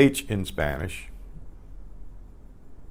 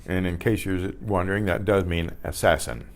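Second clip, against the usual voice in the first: ordinary delivery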